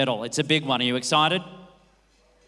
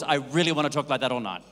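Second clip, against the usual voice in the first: ordinary delivery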